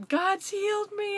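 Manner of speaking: telling every passerby with joy; joyful exclaiming. Retold or imitated. To passing runners, joyful and high. Telling people along the route.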